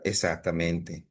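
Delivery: usual speaking voice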